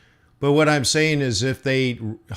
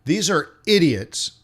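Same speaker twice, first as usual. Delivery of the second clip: ordinary delivery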